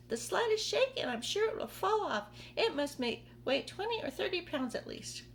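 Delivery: high, worried aunt voice; fretful aunt. Acted. Aunt Spiker fretting the peach will fall, high worried voice. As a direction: fuss like a nervous old aunt.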